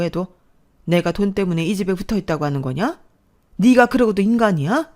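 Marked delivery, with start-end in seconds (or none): 3.6-4.9 louder, higher enraged shout; mother shouting at her son, furious; furious outburst. Acted. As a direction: yell it at your son in disbelief.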